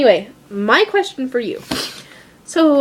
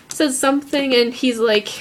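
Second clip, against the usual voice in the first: ordinary delivery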